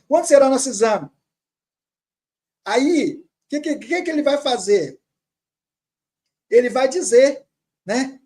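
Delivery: ordinary delivery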